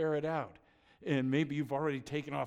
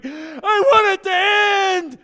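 a slow, very high, very loud wail of panic; screamed plea to end the ride; terrified shout; 19 dB louder, much slower than usual. Retold or imitated. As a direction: beg for it to stop at full voice. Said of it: "And you're going".

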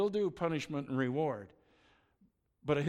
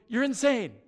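higher and louder mock rant at the friend; exasperated outburst; 6 semitones higher, 6 dB louder than usual. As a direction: tell him off, half-shouting in disbelief. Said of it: "And you're going".

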